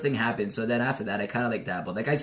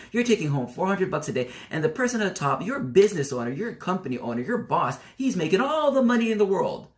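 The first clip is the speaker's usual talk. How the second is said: re-enacted heated rant, pitched up; going at them; 7 semitones higher than usual. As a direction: build a high, indignant case against them. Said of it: "I'd actually just go at them".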